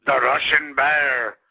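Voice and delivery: put-on loud, slow, amateur Russian accent; drawn-out mock Russian gimmick voice; attempted Russian accent. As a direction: announce it in a hammed-up Russian accent. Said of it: "I can't do a Russian gimmick".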